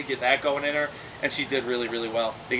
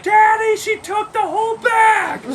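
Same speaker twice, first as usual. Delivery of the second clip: put-on high, loud kid's yell, slowed; a child screaming daddy; excited child shout; 9 dB louder, slower than usual. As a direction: shout it like a little girl tattling. Daughter shouting about the monkey.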